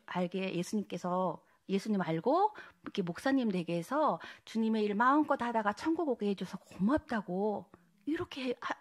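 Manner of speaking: ordinary talk, nothing marked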